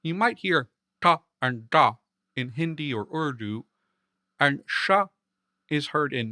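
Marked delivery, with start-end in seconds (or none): 1.0-1.9 "ta" and "da" with the tongue tip curled back; Hindi-style retroflex "ta" and "da". Shown as a demonstration. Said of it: "curling the tip of your tongue backward". 4.7-5.1 "sha" with the tongue curled back, as in Mandarin; retroflex. Shown as a demonstration. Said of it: "it's a retroflex".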